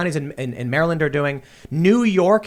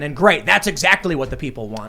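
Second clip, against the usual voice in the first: usual speaking voice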